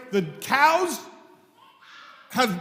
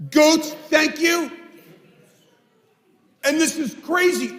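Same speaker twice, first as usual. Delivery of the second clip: angry outburst; 5 semitones higher than usual. Retold or imitated. Angry farmer confronting the rabbi.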